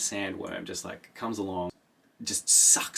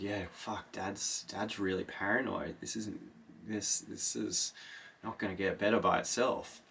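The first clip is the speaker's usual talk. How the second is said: hushed worry; 6 dB quieter than usual. Retold or imitated. Paul worrying about his father.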